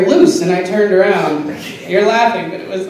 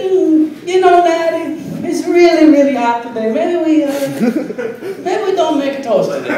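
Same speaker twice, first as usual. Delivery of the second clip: high, lazy toaster voice, pitched way up; toaster's voice; 8 semitones higher than usual. Acted. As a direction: play a sleepy toaster begging a day off. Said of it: "And it said".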